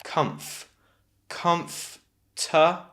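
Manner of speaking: comf, then comfortable slowly split as kumf... tuh... bul; slow, separated syllables of comfortable; broken into sounds. Shown as a demonstration. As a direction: hold the F in comf, no O after it. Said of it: "the O after the F is not pronounced".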